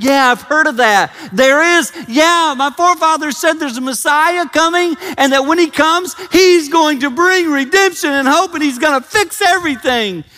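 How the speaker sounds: high, loud, eager voice; eager woman at the well. Acted. Preacher playing the Samaritan woman answering Jesus, high and eager. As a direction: bubble over about the coming Messiah. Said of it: "she kinda saw ... and said".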